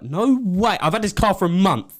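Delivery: high, loud indignant protest; retold outburst, voice leaping up; outraged disbelief. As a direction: relive the outrage over the car. Retold outrage at kicked mirror.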